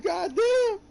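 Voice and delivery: high, loud, cracking "God damn"; a stunned, squeaky yell; shocked exclamation. Retold or imitated. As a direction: blurt it out in disbelief. Reacting to the four-year joke.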